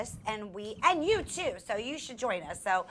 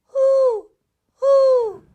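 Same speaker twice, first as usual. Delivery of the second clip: loud, high owl hoot; long hoot, high and strong; 14 semitones higher, 14 dB louder than usual. Shown as a demonstration. Host hooting for children to guess the animal, owl hoot. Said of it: "Let me do it again".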